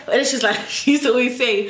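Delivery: usual speaking voice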